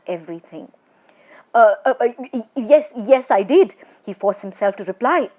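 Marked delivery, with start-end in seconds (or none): 1.5-3.8 terrified stammer. Acted. Terrified man stammering reply.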